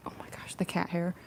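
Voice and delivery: dismayed unvoiced whisper. Real, not acted.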